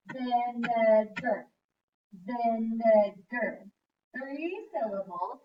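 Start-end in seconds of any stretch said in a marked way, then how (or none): 0.1-1.5 Vin-e-gar, each syllable beaten out slowly; clapping syllables. Shown as a demonstration. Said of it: "Let's clap the syllables". 2.2-3.7 Vinegar split into three slow, loud clapped syllables; Vin-e-gar, each syllable beaten out slowly; clapping syllables. Shown as a demonstration. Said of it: "Let's clap the syllables".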